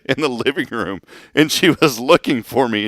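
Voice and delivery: ordinary talk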